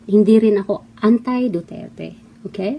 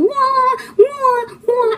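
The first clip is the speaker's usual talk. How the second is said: mock baby crying; 14 semitones higher, much slower than usual. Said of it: "umiiyak na parang mga baby".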